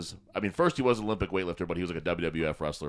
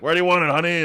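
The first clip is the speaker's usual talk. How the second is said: old guy voiced higher and louder; mock husband; 10 semitones higher, 11 dB louder than usual. Old man asking his wife where the fridge goes, mock eager. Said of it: "and he's like".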